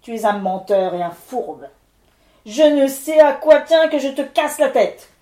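furious spirit. Acted. Levent calling the miller a liar, furious loud spirit. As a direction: thunder out the threat.

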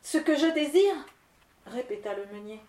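the starving miller begging the wind lord; pleading miller. Acted. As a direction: ask meekly for anything.

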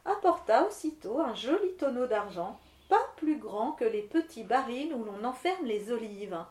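usual speaking voice

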